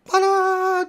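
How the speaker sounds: ordinary talk, nothing marked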